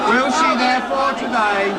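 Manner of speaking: heckled in parliament. Real, not acted. Repeats louder over heckling MPs.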